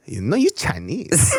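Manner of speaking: put-on slow, lightly accented "you Chinese"; mild mock accent of the waitress; imitating a Chinese accent. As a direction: drawl it like the insistent waitress. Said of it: "She goes".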